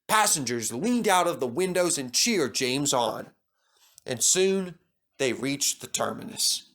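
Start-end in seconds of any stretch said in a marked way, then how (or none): none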